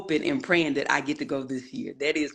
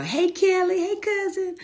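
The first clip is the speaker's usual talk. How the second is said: much higher squealed hey; excited call-out; 4 dB louder than usual. Real, not acted. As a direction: sing out the names. Host calls out to viewers.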